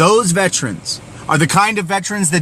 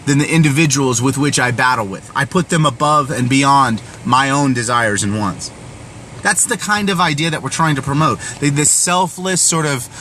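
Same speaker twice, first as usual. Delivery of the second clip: ordinary talk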